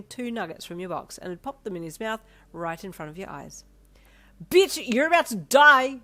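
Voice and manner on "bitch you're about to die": high, loud, slow scream. A narrator's reading. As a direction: scream it in playful rage. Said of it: "you screamed".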